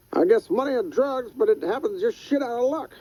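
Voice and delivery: put-on wheezy old man, wry and unafraid; old Skinner's voice. Skinner answers the gunman in a high old-man voice.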